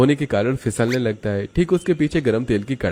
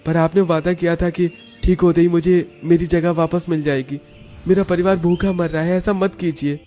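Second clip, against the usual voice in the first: high-pitched desperate appeal; imploring, pitch held high; pleading worker; 8 semitones higher than usual. Acted. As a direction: beg the boss to keep his promise. Akash begs his employer.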